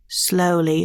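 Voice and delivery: ordinary talk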